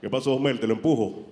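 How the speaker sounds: a hint of a big helpful stranger; tall man's voice. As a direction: stranger offering to push the car. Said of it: "me dice".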